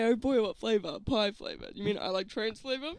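normal talking voice

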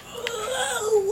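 ordinary talk, nothing marked

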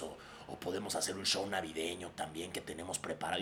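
a hint of a low, raspy little devil. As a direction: softly play the stoned elf. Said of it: "con voz del diablito".

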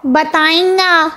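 a girl mocking the magician in a nasal tone. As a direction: push it through the nose. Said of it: "وہ ناک میں بولی".

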